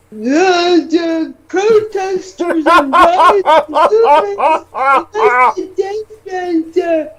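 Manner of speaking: whiny mocking quote voice; mock troll voice. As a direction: sneer out the troll's words.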